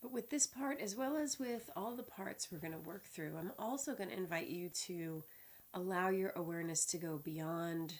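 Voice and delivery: unhurried body-scan guidance with long pauses; measured, spacious meditation voice; guided meditation. Real, not acted. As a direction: lead them calmly through the body, phrase by phrase.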